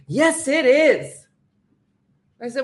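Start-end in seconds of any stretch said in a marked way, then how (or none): 0.1-1.3 firm gate agent. Retold or imitated. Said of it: "gate agent answers".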